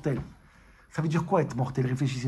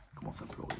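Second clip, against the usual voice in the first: breathy whisper, no voice; 15 dB quieter, faster than usual. Acted. Teacher whispers the rabbi's weeping to listeners. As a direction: drop to a bare whisper.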